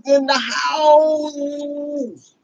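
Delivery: drawn-out, shouted announcement; slow hype yell. Real, not acted. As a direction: announce him like a ring MC, stretching every word.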